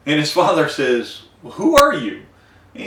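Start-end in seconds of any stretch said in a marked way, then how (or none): 1.4-2.2 startled. Retold or imitated. Startled blind father.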